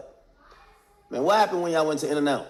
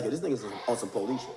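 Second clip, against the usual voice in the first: quick, much softer, lower muttered aside with a laugh; 6 semitones lower, 7 dB quieter, faster than usual. Retold or imitated. Dirk suspecting the caller, quick quiet amused aside. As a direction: say it under your breath, amused. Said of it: "Dirk back then was like".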